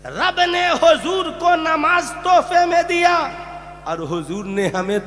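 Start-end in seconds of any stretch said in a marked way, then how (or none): 0.0-3.2 high, declaiming pulpit voice; emphatic preaching. Real, not acted. Preacher explaining the slighted gift to the congregation, high and emphatic. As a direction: lift the voice to press the point.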